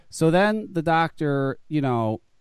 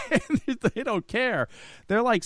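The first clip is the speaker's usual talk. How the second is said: laughing; 8 semitones higher, slower than usual. Real, not acted. Telling the delivery story to a friend, laughing. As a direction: laugh spilling into slow, high words.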